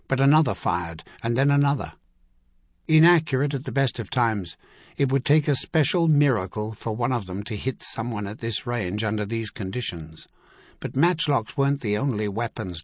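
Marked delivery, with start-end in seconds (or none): none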